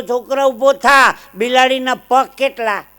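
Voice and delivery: high, loud classroom command; booming schoolmaster at high pitch; stern teacher quizzing. Acted. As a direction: call the pupil out loudly. Teacher grills a pupil in joke.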